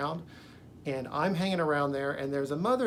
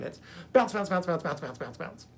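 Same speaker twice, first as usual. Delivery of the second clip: bouncy chant. Retold or imitated. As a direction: bounce the words like a spring winding down. Acting out serpent bouncing around.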